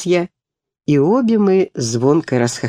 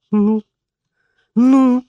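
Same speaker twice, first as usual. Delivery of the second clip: higher, louder, urgent trembling; 8 semitones higher, 4 dB louder than usual. A narrator's reading. Sick girl anxiously asks her friend what happened, trembling. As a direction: press for the verdict, voice shaking. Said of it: "невольно дрожащим голосом проговорила я".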